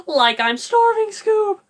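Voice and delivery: high loud whiny Shaggy plea; hungry Shaggy. Shown as a demonstration. Shaggy whining to Scooby about starving, high and loud. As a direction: beg Scoob for food. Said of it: "Or it could be".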